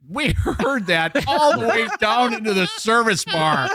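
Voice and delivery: manager's mock reprimand. Manager stops worker downstairs about the belch, mock reprimand. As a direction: reprimand him with stretched, incredulous emphasis. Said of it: "He goes".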